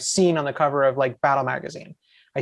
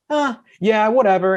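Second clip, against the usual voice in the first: mock unimpressed shrug, pitched up; offhand; 7 semitones higher, 4 dB louder than usual. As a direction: act out a clueless, dismissive reply. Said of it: "I just kinda was like".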